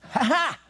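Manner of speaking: quiet high "ha ha" from the back; soft mocking laugh. Kids in the back mocking the lady. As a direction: copy her under your breath.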